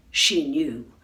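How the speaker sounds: plain reading voice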